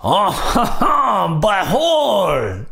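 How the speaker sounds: butthole said in a slow, hammed-up French accent. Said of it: "but in a French accent".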